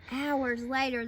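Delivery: usual speaking voice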